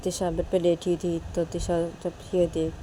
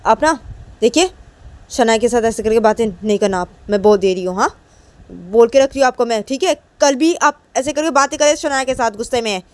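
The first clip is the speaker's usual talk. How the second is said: put-on a girl warning her mother, louder and insistent; daughter scolding mom; 5 semitones higher, 9 dB louder than usual. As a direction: lay down the law to mom. Tisha warns mom angrily.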